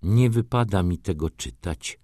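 usual speaking voice

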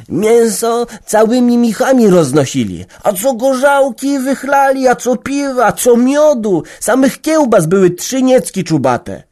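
put-on high, loud eager boy telling; excited. A narrator's reading. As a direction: gush about the wedding feast. Boy excitedly describes wedding. (podekscytowany chłopiec)

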